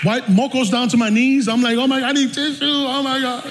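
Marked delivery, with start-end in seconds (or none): 1.8-3.4 high, mock-tearful wail; imitated crying. Preacher re-enacting bawling over family photos, mock crying voice. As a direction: play up a hammed sob. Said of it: "I'm crying so hard".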